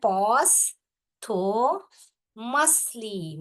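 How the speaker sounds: Posthumously stretched very slowly into four syllables. Shown as a demonstration. Teacher sounding out Posthumously in four syllables. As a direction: pull each syllable apart. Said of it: "spelling को four syllable में दिया".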